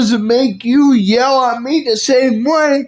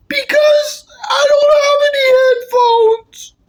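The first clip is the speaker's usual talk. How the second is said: high, crying shout; crying whine; 12 semitones higher, faster than usual. Acted. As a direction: wail it like a sulking kid. Kid complaining to parent.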